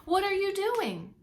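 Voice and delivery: high and slow, called across the bank; calling out. Acted. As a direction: keep calling out to Otter. Hedgehog calls to Otter.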